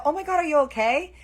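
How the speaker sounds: loud, high mock-panicked question; pretend alarm. Auntie staging an accident for parents, high loud alarm. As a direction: overplay the concern so others hear.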